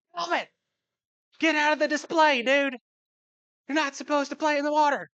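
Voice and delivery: high, loud yell across the store; calling out. Retold or imitated. Dad yelling at his son in the koi pond, loud. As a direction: shout at the kid to get out of the display.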